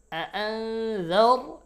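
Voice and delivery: আআনজার recited high, ending in a heavy, rounded r; thick r. Shown as a demonstration. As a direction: darken the final r of আআনজার. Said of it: "এখানে মোটা র". (আআনজার-এর র মোটা করে)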